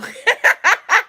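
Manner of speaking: a loud, high burst of laughter. Real, not acted. Laughing at the psycho exes.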